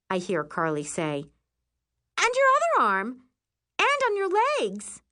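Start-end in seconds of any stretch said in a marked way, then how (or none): 2.2-5.0 very high, loud squeal of alarm; alarmed outcry. A narrator's reading. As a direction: exclaim as it gets worse. Bunkmate alarmed at spreading rash.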